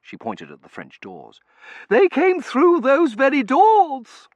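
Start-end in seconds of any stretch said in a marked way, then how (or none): 1.9-4.3 haughty lady voice. Acted. Mrs Meering character voice.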